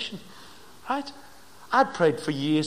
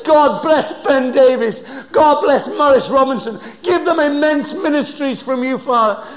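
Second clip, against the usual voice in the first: shouted blessing; 10 semitones higher, 14 dB louder than usual. Retold or imitated. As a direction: call it out loud over them like a proclamation. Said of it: "I stood up and I shouted out".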